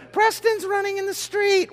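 put-on a little kid warning dad; tattling kid. Kid voiced in acted morning scene.

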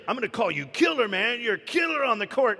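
loud, high hype-up from a team coach; hyped coach nickname. Pretend. Coach hyping his star player, loud and high.

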